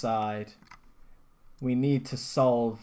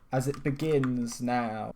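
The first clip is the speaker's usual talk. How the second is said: usual speaking voice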